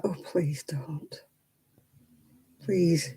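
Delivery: slow, soft retold plea; imitating a pained, quiet appeal; hushed plea. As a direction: plead under your breath, drawn out. Quietly pleading inward reaction to swearing mums.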